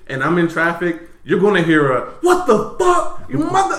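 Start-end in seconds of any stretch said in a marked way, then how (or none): 2.2-3.8 high-pitched road-rage outburst; angry yelling. Acted. Driver caught on the phone in traffic, cursing another driver, high angry yell. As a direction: act out cursing someone out, pitch way up. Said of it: "I'm yelling, I'm screaming".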